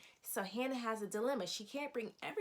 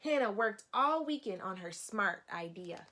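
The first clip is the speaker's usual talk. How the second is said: expressive picture-book narration for a class; storybook read-aloud; 5 dB louder than usual. Acted. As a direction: lively, clear reading to young children. Teacher reads picture book aloud.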